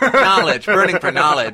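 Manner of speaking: loud, laughing slam poem finish; mock slam poet. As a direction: belt it like a parody slam poet. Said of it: "making fun of it".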